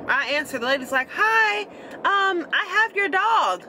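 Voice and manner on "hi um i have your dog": much higher, polite stranger on the phone; caller's voice. Retold or imitated. Retelling the stranger who called about her dog, high polite voice. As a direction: lift way up into the caller's sweet phone voice.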